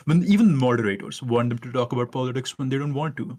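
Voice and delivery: ordinary delivery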